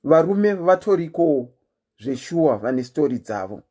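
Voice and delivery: usual speaking voice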